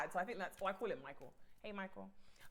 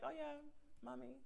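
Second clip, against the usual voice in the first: softer, higher, cooing; imagined lover; 6 semitones higher, 9 dB quieter than usual. Acted. Host acting out a man calling guest mummy, soft cooing voice. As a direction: purr it as a playful boyfriend. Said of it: "he's like".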